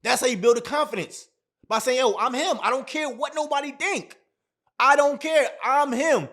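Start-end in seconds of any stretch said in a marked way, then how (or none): 2.0-6.3 hyped self-affirmation. Pretend. Hyping himself up, mirror talk.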